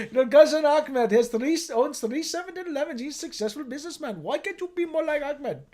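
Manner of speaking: put-on compare them reproachfully in an Indian accent; put-on Indian parent accent.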